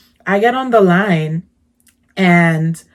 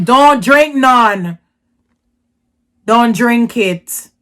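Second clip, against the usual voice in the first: pretend warning; 3 semitones higher, much slower than usual. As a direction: drag out each word as a firm imagined warning. I didn't say that.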